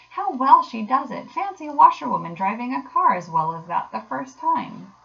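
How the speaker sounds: impressed, swooping delivery; admiring. A narrator's reading. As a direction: marvel aloud at a surprising feat. Gentlemen applaud the washerwoman driving.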